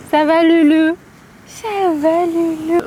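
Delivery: high, sing-song pet voice; talking to a dog. Real, not acted. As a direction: coo at the little dog. Parle au chien. (voix aiguë pour parler au chien)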